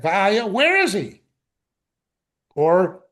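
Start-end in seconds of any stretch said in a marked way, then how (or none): 0.0-1.2 Va'ayo punched higher and louder; emphatic Va'ayo. Shown as a demonstration. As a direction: demand it, stress on Va'ayo. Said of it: "we could have it in emphatic".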